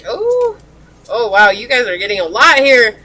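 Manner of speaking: high, loud gasp of surprise; surprised. Real, not acted. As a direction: react to cards jumping out. Cards suddenly fall out.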